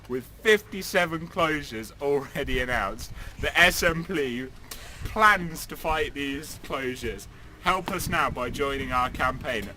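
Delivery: loud, slow rallying advert voice; slow, loud appeal to save post offices; campaign ad read. Acted. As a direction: drive each line home like a campaign ad.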